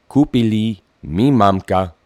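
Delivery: usual speaking voice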